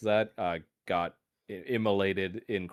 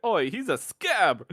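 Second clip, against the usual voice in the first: slow, louder mock-British heckle; accusing heckler; 10 semitones higher, 6 dB louder, slower than usual. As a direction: call him a scab, drawn out. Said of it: "this guy's like".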